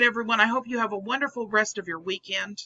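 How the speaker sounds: ordinary delivery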